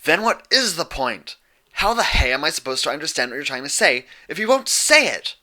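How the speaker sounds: loud, high yell of frustration; angry pony yelling back at Twilight. A narrator's reading. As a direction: yell it right back at her. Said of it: "Dash yelled back".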